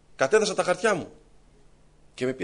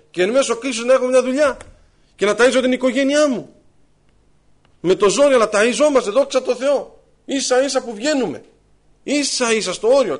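preaching at full force; 3 semitones higher, 7 dB louder than usual. Acted. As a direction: proclaim it to the whole church. Preaching climax to the brothers.

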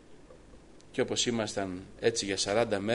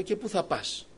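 higher, startled asking; surprised question; 8 semitones higher, slower than usual. Retold or imitated. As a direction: ask as if caught off guard. Son asks dying father.

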